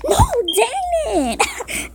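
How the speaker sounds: high, slow, drawn-out dismay; dismayed cry. Real, not acted. Player losing the round, high slow dismay. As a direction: groan it out as you get caught.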